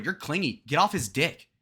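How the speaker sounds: ordinary talk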